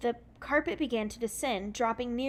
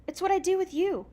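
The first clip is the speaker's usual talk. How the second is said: put-on higher, louder childlike exclaim; little sister's cheeky exclaim, higher and louder; 9 semitones higher, 6 dB louder than usual. Little sister teasing Sabrina, exclaimed higher and louder. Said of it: "the little girl exclaimed".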